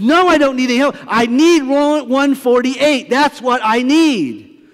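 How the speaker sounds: hammed-up irate hotel guest; mock demanding. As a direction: spell out each word like a furious guest. Guest insists on room.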